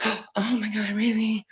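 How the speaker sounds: gasping, very high-pitched disbelief; gasp, then squeal it in put-on shock; mock amazement. Pretending to be one of those reactions, mock amazed gasp.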